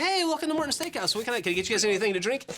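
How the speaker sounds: high-pitched, chirpy server welcome; imitated waiter greeting. Acts out steakhouse waiter's greeting.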